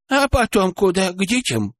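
put-on high, curious old woman. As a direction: ask it like a nosy granny. Old woman character asking.

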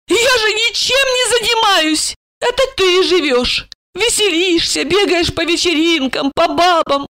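high-pitched tipsy woman lamenting; heartfelt drunken complaint. A narrator's reading. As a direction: pour out self-pity in a high voice. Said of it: "С чувством вопросила она".

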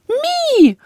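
high squeaky creature cry; a tiny beast screeching in attack; creature shriek. A narrator's reading. The furious familiar tears at Jeyna, high squeak.

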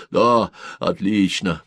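plain reading voice

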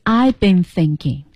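I've been thinking 说得低调缓慢; low-key modest. Shown as a demonstration. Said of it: "很低调". (低调谦逊地说)

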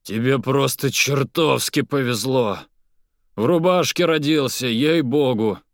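loud, hearty, relieved exclaiming; joyful relief. Acted. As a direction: boom it out with relief. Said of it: "радостное облегчение".

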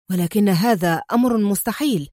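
ordinary delivery

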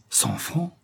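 high, hushed question rising at the end; dumbfounded. Acted. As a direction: echo it in stunned disbelief.

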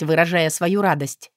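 usual speaking voice

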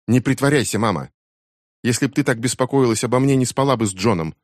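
a daughter hurling an accusation at her mother; accusing teen. A narrator's reading. (гневное обвинение дочери, выше и громче)

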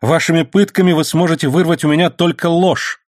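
high-pitched defiant retort; defiant protest. A narrator's reading. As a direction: defy your interrogator. Prisoner defies his interrogator.

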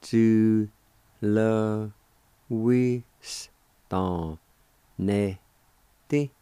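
each of "Ju le wi stan ne ti" on its own, gaps between; syllable by syllable. Shown as a demonstration. Teacher showing how job is pronounced, syllable by syllable. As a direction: firmly sound out Ju, le, wi, stan, ne, ti separately. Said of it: "it's pronounced like this".

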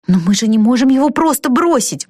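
high, urgent objection; exclaiming. A narrator's reading. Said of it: "воскликнула Вика". (взволнованно восклицает, повыше)

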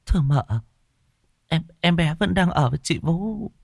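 nervous maid stalling the mistress; timid young maid. Acted. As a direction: stammer out an answer to your mistress. Narrator voices the maid Cẩm.

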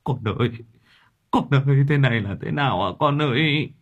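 put-on a touch of a father's sob over his child; father mildly wailing over his son's body. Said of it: "ôm lấy xác con mà khóc lóc".

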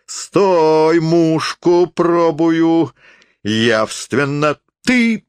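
high, loud, very drawn-out shout; drunken forester, commanding. A narrator's reading. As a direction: bellow slowly while taking aim. (пьяный лесник кричит высоко, врастяжку)

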